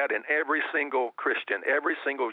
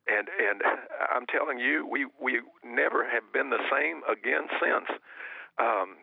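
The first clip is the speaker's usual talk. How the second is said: ordinary talk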